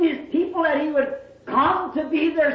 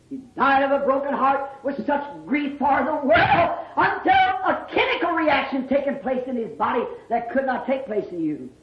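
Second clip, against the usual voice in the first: ordinary talk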